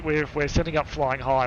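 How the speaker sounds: ordinary delivery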